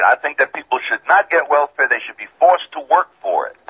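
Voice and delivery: usual speaking voice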